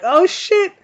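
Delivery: sudden high-pitched "Oh, shit"; stunned surprise. Retold or imitated. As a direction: jump way up in pitch, caught off guard. Stunned he is still standing.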